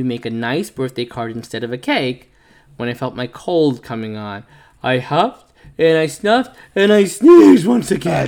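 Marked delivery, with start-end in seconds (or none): 4.8-8.3 rising louder and higher; building to a sneeze. Acted. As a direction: wind up for the big sneeze.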